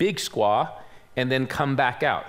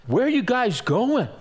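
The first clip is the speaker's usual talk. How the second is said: higher-pitched puzzled query; imitated concerned stranger; 7 semitones higher than usual. Stranger stopping lost hikers, imitated puzzlement. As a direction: question them as the stranger, pitched up.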